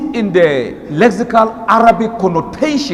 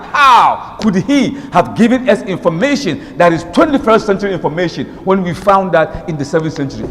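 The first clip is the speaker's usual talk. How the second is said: ordinary talk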